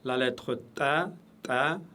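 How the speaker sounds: "ta" said light with a clear vowel; light Arabic ta. Shown as a demonstration. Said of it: "toujours légère".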